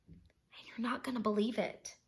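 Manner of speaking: unvoiced conspiratorial whisper. Wolf whispers an aside to the listener. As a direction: lean in and whisper it.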